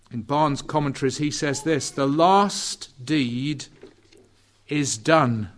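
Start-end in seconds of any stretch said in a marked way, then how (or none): 1.9-5.5 slow solemn quote. Shown as a demonstration. Preacher reading commentator quote.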